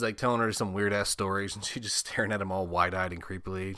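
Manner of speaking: usual speaking voice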